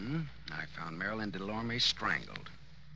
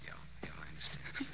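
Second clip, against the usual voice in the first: quick, softer whispered reassurance; 3 semitones lower, 11 dB quieter, faster than usual. Acted. Detective soothing the shaken brother, soft whisper. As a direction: hush him gently.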